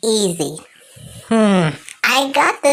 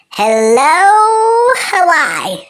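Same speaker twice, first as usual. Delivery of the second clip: excited greeting; 6 semitones higher, 4 dB louder, much slower than usual. Acted. Arriving in Hawaii, calling out.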